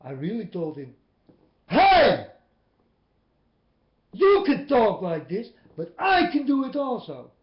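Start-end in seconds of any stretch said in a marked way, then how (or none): 1.7-7.3 very loud retold yell. Warning the blabbermouth, yelling very loud. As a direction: blast it at him as a warning. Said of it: "I was yelling at this man".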